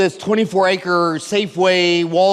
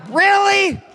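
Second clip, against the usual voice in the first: bigger incredulous shout; 10 semitones higher than usual. Real, not acted. To the crowd, mocking their weakness, a bigger incredulous shout. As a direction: push the disbelief over the top.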